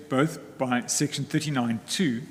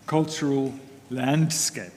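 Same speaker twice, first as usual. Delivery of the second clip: landscape slowed down and leaned on; emphasis on landscape; slower than usual. Real, not acted. Counsel to the court, slow stress on "landscape". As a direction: draw out "landscape" with weight. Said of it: "I emphasize the word landscape".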